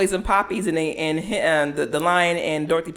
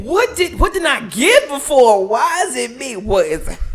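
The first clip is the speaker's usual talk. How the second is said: mock panic; 6 semitones higher, 7 dB louder than usual.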